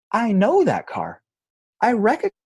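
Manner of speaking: put-on high, loud little-kid voice; five-year-old kid. Five-year-old spotting the preacher's old car, high child voice. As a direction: pipe up like an eager small child. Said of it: "had to have been five years old".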